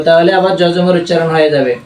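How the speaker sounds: normal talking voice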